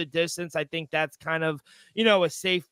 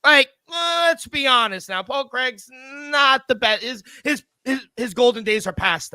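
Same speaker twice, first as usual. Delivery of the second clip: heated excitement; 7 semitones higher, 5 dB louder than usual. Real, not acted. Heated debate over fighters.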